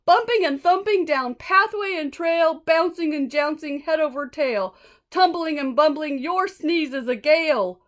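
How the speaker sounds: high, loud pleading creature; pleading buffalo. Acted. Buffalo pleading with the elephant, high and loud. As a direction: beg him breathlessly in rhyme.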